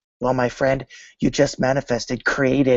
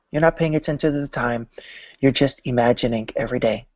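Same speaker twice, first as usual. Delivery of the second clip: ordinary talk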